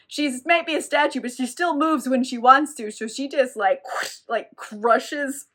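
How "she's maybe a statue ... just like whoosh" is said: ordinary delivery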